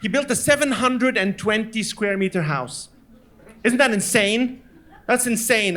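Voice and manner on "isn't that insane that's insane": ordinary talk, nothing marked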